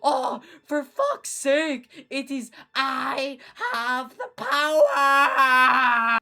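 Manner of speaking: high, loud, very slow exasperation; exasperated shout. Acted. Gru shouting Dayman's catchphrase at him. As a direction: drag it out fed up. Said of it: "said Gru".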